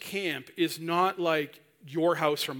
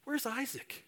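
wondering; 8 semitones higher, 6 dB quieter than usual. Acted. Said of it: "Sarah starts wondering".

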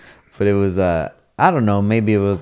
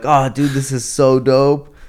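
loud, high and stoked; 5 semitones higher, 6 dB louder than usual. Retold or imitated. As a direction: gush about the car. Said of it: "And like".

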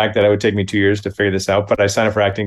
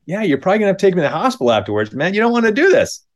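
rushed warning; 9 semitones higher, much faster than usual. Retold or imitated. Warning the kid off.

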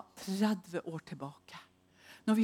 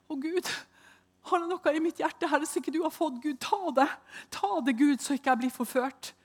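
much higher, pleading; anxious prayer; 8 semitones higher than usual. Retold or imitated. Praying to God after waking afraid, high anxious plea. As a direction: beg God with a lifted voice.

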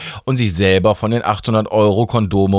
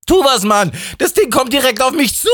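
high-pitched, frantic character shout; panicked cry. A narrator's reading. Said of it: "rief Steffi". (panisch und sehr hoch gerufen)

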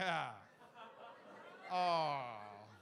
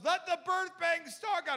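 loud, higher commanding proclamation; loud prophet's decree; prophetic decree; 8 semitones higher, 7 dB louder than usual. Retold or imitated. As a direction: call down labor like a prophet. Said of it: "Jill Austin goes".

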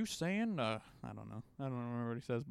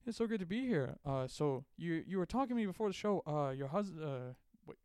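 host turning suspicious; 5 semitones higher than usual. Pretend. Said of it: "getting mad at them when they say they have husbands".

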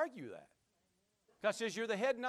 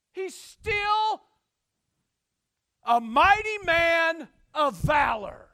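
loud, high preacher's shout; emphatic proclamation; 9 semitones higher, 12 dB louder, much slower than usual. Real, not acted. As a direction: thunder it out slowly, word by word. Preacher proclaiming at full force.

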